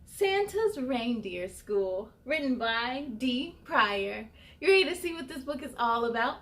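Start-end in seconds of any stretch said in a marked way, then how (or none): 0.0-4.3 slow, drawn-out announcement; slow title read. Acted. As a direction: savor each word slowly.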